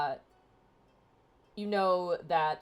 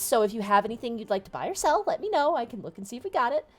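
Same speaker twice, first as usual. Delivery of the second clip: pixie; 5 semitones higher, faster than usual. Acted. GM voices gnome niece.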